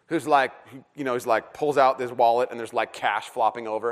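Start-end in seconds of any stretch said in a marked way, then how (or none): none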